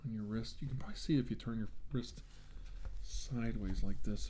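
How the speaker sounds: ordinary talk, nothing marked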